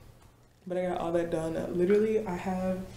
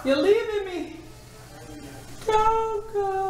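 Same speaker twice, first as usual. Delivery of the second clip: mock dramatic whine; slower than usual. Teasing coworker leaving for the day, high mock whine. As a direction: ham up being abandoned, squeaky.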